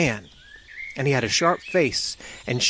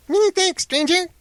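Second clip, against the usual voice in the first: much higher, eager fiery thanks on "Many thanks"; excited cry; 4 dB louder than usual. A narrator's reading. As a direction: crackle out the thanks brightly. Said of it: "he cried, snapping his fingers".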